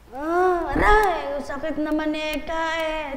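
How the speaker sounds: high, loud mock wail of pain; pained complaint. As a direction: imitate a slapped patient whining in protest. Acts out patient being slapped awake. (kunwaring umaaray sa sakit)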